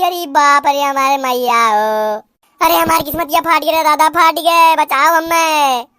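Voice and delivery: put-on old woman bewailing her fate, overdone; mock lament crying to the gods; mock wailing lament. Saas wails about her fate.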